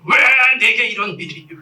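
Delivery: hammed-up, high-pitched angry wail of why me; acted anger. Preacher rails at God over his hair loss, mock anger. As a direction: rail at heaven in mock outrage. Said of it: "그럼 막 분노하는 거예요".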